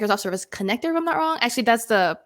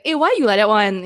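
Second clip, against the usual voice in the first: louder, casual Singaporean challenge; Singlish accent; 6 dB louder than usual. Shown as a demonstration. Host shows loose grammar to co-hosts, in a Singaporean accent. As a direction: toss it out in colloquial Singlish. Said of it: "the Singaporean style of talking".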